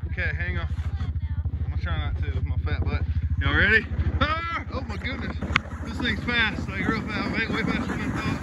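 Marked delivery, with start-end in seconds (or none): none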